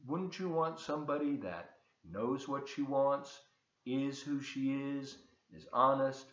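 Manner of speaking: plain reading voice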